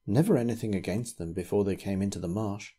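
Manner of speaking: usual speaking voice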